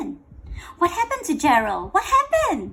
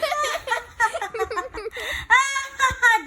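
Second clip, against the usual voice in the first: mocking laughter; 12 semitones higher than usual. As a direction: cackle like the jeering animals. Animals laughing.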